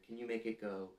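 usual speaking voice

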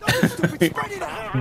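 high laugh. Real, not acted. Laughing at teammate remark.

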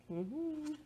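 plain reading voice

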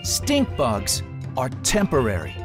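plain reading voice

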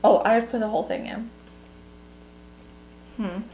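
ordinary talk

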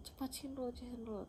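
hushed, hurried mock whisper; retold urgent whisper. Hurrying a friend for the surprise, imitated whisper. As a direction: whisper it secretly, urging someone on.

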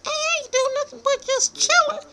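tiny high squeaky mouse voice. Acted.

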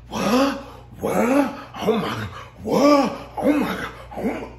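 overreact like a scandalized guest; mock shock. Mocking guests overreacting to a rumor, high mock shock. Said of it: "this big thing of".

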